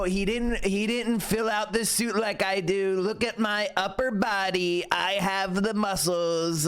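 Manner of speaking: Xi doing stand-up. Retold or imitated. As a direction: lampoon a bragging head of state. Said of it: "can you imagine Xi going up there and doing a stand-up skit".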